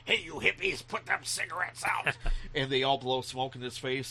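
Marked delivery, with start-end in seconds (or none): 0.1-2.2 raised-pitch scolding janitor; old janitor. Retold or imitated. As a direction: order the hippies about like an old caretaker. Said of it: "a janitor comes by and says".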